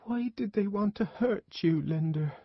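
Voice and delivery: put-on high, small child's voice; tearful child. A narrator's reading. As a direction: ask it like a hurt little boy in tears. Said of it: "he was also crying".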